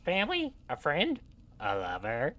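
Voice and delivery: squeaky gnome teasing; Grupham's gnome voice. Acted. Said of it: "this is my gnome voice for Grupham".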